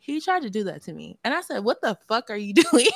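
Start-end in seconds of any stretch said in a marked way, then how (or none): 1.6-2.9 loud, higher indignant question with a laugh; indignant mock yell; retold outrage. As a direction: act out outraged disbelief, half laughing. Retold shock at unwanted kiss.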